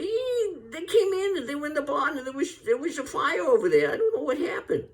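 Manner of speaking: put-on very high, squeaky, fast old-man voice; later Walter Brennan. Comic showing Walter Brennan's later voice, very high. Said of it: "Then later we say".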